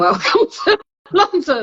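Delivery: laughing through the phrase. Retold or imitated. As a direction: repeat it while laughing. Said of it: "his response was".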